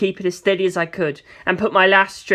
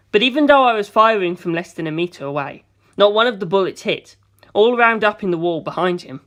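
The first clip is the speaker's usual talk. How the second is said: ordinary delivery, nothing marked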